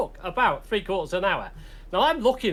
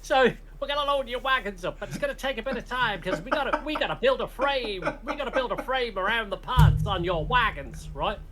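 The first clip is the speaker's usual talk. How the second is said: mock American colonel; 5 semitones higher than usual. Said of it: "Jimmy Stewart-esque".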